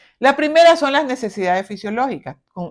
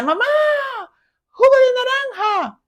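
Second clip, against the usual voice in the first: high shouted call across the house; child yelling for mom; 13 semitones higher than usual. Retold or imitated. Child calling mother from afar for juice, high yelling call. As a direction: holler for mom from another room. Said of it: "el niño quiere jugo de naranja y grita".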